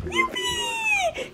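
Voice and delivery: excited cheer. Real, not acted. Cheering about jumping.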